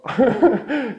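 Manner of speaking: loud, high burst of laughter; real amused laugh. Real, not acted. Teacher cracks up at own British demo, laughing.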